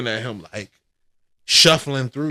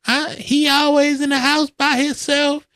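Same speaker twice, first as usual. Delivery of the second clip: much higher mock mom voice; imitating mom; 5 dB louder than usual. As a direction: squeak it out like a worried mother. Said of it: "My mom was like".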